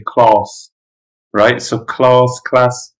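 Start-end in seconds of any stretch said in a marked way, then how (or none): 0.0-0.7 London "class" with a long, back "ah"; London accent. Shown as a demonstration. Teasing students from London, London accent. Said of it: "Also in London". 1.8-2.4 London "class" with a long "ah"; London accent. Shown as a demonstration. Contrasting two pronunciations for students, London accent. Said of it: "difference between class and class".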